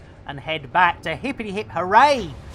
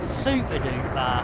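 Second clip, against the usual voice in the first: normal talking voice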